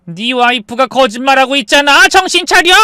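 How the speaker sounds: high, loud, rising to a shout; angry yell. Acted. Mother telling son his wife lies, building angry shout. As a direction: yell to bring them to their senses.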